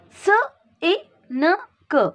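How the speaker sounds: "S-I-N-K" as "suh, ih, nuh, kuh"; phonic sounding out. Shown as a demonstration. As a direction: sound out "S-I-N-K" with gaps between phonemes. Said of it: "The sound of I is E".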